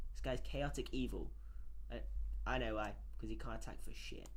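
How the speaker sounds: ordinary talk, nothing marked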